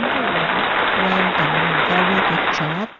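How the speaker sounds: loud, cheerful recorded cheer; recorded praise. Acted. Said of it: "recorded praise".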